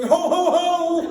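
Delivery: big, slow, booming Santa laugh shout. Acted. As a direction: belt it out like a desperate Santa. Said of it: "he ran out shouting".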